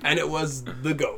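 slow, drawn-out dramatic twist; mock plot reveal. Co-host parodying a twist about the goat, mock-dramatic. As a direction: deliver it like a hammy big reveal.

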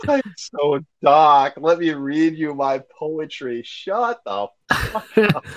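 slow, moody, mocking goth drawl; hammed-up gloomy goth; mock goth boy. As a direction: send up the brooding poet, then snap back. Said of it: "mocking whiny goth boyfriend".

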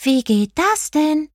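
slow, high-pitched mouse voice; high, drawn-out puzzled squeak; baffled mouse call. A narrator's reading. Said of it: "rief Clara".

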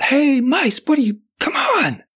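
high-pitched mock call; retold shout. Storyteller calling mice from the car door, high retold shout. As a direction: call out high, as if across a distance, without full volume. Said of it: "And I shout".

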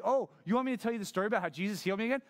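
fast, high-pitched sarcastic rant; sassy, exasperated rush; fed-up sass. Acted. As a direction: rattle it off in mock disbelief. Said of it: "He's fed up with the Pharisees".

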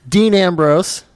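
usual speaking voice

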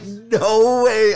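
high, loud mock-stunned exclamation; retold disbelief. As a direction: exclaim it high, acting out the shock. Retelling shock at the trade.

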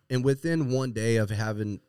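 usual speaking voice

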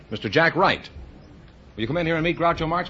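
ordinary delivery

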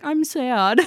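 mock-mopey little whine; mock sad. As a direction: playfully pout it through giggles. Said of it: "alone and sad and depresso".

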